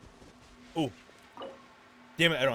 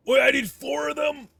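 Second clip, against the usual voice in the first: shocked shout; 12 semitones higher, 6 dB louder than usual. Real, not acted. Needs four boss drops.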